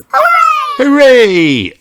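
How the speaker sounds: high, loud joyful cheer; loud high cheer of the whole family; cheering hooray. Acted. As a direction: cheer it out with the crowd. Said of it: "everyone cheered".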